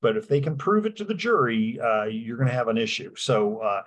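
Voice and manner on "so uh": normal talking voice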